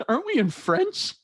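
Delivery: very high, laughing disbelief; incredulous retort. Retold or imitated. Student answering the teacher's taunt, high incredulous laugh. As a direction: toss it back half-laughing. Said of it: "I was like".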